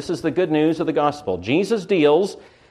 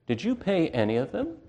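a softer, sly accusing question; taunting Satan; 4 semitones higher, 8 dB quieter than usual. Retold or imitated. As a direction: needle them quietly like the accuser. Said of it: "says Satan".

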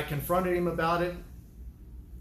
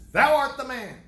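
higher, louder prophet accusing the king; prophet's accusation; 7 semitones higher, 8 dB louder than usual. Retold or imitated. Nathan the prophet accusing King David, higher and louder. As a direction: point the finger: thou art the man. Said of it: "Nathan went to David and he says".

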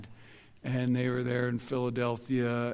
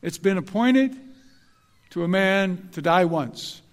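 louder, sarcastic parody of the verse, quick on better place; mock rewrite; 4 semitones higher, 8 dB louder than usual. Preacher parodying how people misquote Hebrews, loud and sarcastic. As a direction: parody the scripture with a rising sneer. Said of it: "Here's what we say".

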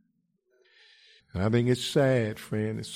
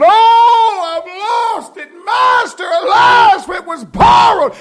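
high, loud wailing cry of loss; prophet crying out; 17 dB louder, slower than usual. Acted. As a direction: wail it like a man who lost what was borrowed. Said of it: "He stopped working and started crying".

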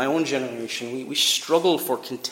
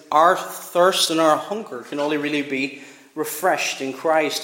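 normal talking voice

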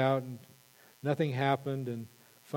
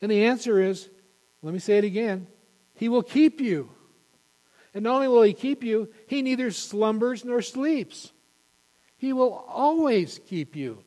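fervent preaching climax; 8 semitones higher, 6 dB louder than usual. Real, not acted. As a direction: proclaim it with full conviction. Climax of the sermon.

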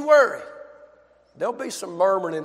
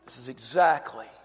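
tender Jesus voice; 5 semitones lower, 7 dB quieter than usual. Acted. Jesus voiced approving woman's act.

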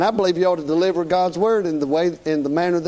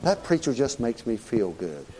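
low, soft mocking churchgoer; a hint of a smug churchgoer; 7 semitones lower, 6 dB quieter than usual. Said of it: "they'd probably rather have that".

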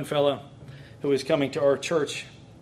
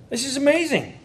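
higher, delighted exclamation; excited outburst, higher; real excitement; 9 semitones higher than usual. Real, not acted. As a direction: share the thrill of the passage. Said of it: "acting all goofy".

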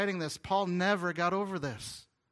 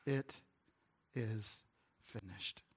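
fading, each word lower and softer; dying words; 6 semitones lower, 9 dB quieter, much slower than usual. Retold or imitated. Jesus on the cross, fading into near silence. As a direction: let the words sink away with the breath.

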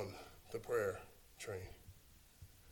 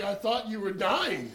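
slow, higher, laughing explanation; laughing wife; 7 semitones higher, 11 dB louder than usual. Retold or imitated. Wife who grabbed the gun, laughing explanation. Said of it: "She's like".

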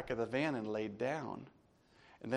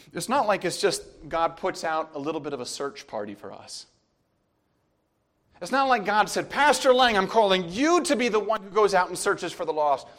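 loud, high, driving sermon voice; preacher building up to his congregation, loud; preaching at full force; 7 semitones higher, 13 dB louder than usual. Acted. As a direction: preach it hard to the crowd.

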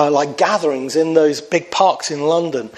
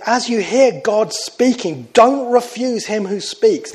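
raised and slow, a lightly voiced divine warning; solemn voice of warning; 6 semitones higher than usual. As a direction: let God's warning ring out slowly. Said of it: "And he says".

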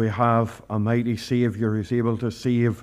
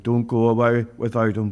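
ordinary talk, nothing marked

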